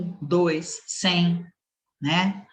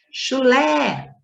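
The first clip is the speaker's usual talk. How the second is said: "Chulé" with a long, high LÉ; stress on final LÉ; 8 semitones higher, 3 dB louder than usual. Shown as a demonstration. Teacher showing pupils the tonic syllable, stressed LÉ in "Chulé". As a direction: swell hard onto the LÉ of "Chulé". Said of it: "a sílaba tônica é o lé".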